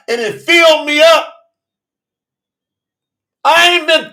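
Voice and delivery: loud, rising preacher's call; fiery preaching. Real, not acted. Preacher shouting to his listeners about the well. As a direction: preach it out to the congregation, full force.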